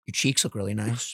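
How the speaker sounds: imitating a low, soft come-on; mock seductive murmur; low creepy flirting. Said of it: "Jay kept going".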